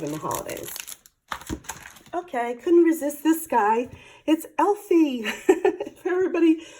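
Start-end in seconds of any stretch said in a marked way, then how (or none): 2.1-5.2 delighted. Real, not acted. Delighted showing cute elf stamp. 5.3-6.0 breathy giggle. Real, not acted. Laugh after naming Elfie.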